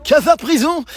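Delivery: high, loud cry; high-pitched, rising yell; loud exclamation. Real, not acted. Exclaims on discovering friend's room.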